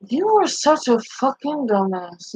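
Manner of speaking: usual speaking voice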